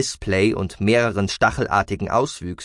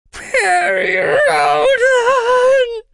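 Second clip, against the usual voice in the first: piercing, drawn-out high shriek; very high, loud cry for rescue; panicked scream; 9 dB louder, much slower than usual. A narrator's reading. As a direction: scream the name in sheer panic. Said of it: "rief es mit panischer Stimme".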